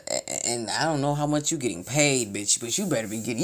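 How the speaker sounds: normal talking voice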